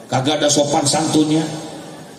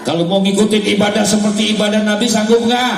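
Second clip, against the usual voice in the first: higher, quicker repeat of the question; raised rhetorical challenge; 6 semitones higher than usual. Real, not acted. Preacher challenging the congregation about matching the Prophet's worship, raised urgent voice. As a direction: push the question up high at the congregation.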